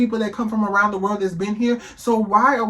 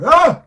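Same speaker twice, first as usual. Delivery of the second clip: short high shriek of disbelief; loud shocked yelp; 11 semitones higher, 10 dB louder than usual. Real, not acted. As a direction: let out a sharp, loud cry. Outburst reacting to the video.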